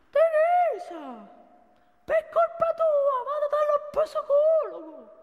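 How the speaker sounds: high, slow shrill mock boss voice; imitating the boss. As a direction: screech it like an exasperated boss. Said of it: "ha minacciato di licenziarmi".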